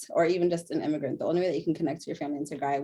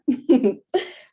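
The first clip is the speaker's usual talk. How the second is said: short bright laugh; 7 semitones higher, 8 dB louder than usual. Real, not acted. Speaker laughs after praising the intro. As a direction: laugh it off lightly.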